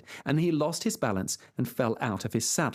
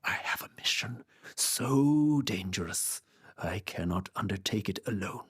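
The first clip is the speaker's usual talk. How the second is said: whispered; 5 semitones lower than usual. Acted. Knight confiding a secret mission to Sancho, whispered. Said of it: "leaned in and whispered".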